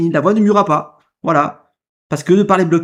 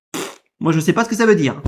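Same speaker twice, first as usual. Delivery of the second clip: normal talking voice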